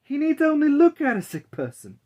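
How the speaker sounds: high, loud amazed crowd voice; excited townsfolk. Acted. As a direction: marvel out loud like gossiping townsfolk. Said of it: "everyone said".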